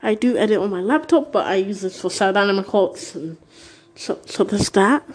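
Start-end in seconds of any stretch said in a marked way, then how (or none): none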